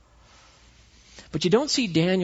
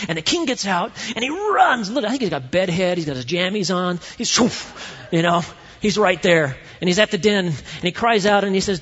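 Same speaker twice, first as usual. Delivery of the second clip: rising, louder storytelling; growing urgent retelling, pitched up and louder; building excitement; 5 semitones higher, 5 dB louder than usual. Real, not acted. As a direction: get swept up in the king's rush. Excited retelling of the king running.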